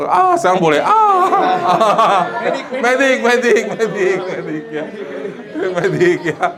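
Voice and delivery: tinggi, memanggil medis sambil tertawa; pura-pura prajurit terluka sambil tertawa; teriak medik sambil tertawa. Pretend. Soldier calling for medic, laughing. (prajurit terluka memanggil medis, setengah bercanda)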